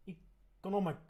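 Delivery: "economic" said loudly as a set term; careful pronunciation. Shown as a demonstration. Correcting a friend's made-up word, "economic" said with deliberate care. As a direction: give "economic" as the way it must be said. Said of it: "You gotta say it like that".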